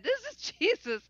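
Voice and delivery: high laugh breaking into the words; giggling in disbelief. Retold or imitated. Inner thought, laughing.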